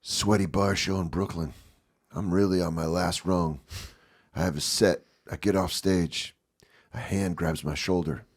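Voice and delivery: low, soft recital of a self-important post; mock Facebook post. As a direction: read out a humblebrag in short, measured phrases. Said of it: "someone's post would be like".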